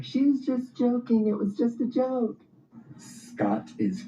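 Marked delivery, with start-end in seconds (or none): 0.0-2.4 very high, pleading friends; friends covering. Retold or imitated. Friends covering for her to the husband, very high. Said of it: "they're like".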